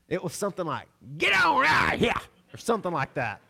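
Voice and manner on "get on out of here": high, loud shout chasing off a dog; father yelling at the neighbor's dog; dad's shout. Retold or imitated. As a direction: bellow it to run the dog off. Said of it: "the thing that came out of my dad's mouth".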